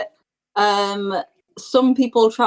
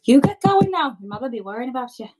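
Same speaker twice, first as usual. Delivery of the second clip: motherly send-off; 3 semitones higher than usual. Acted. Game master voices woman sending player home.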